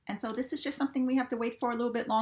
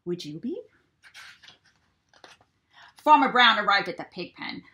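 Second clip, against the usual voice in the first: plain reading voice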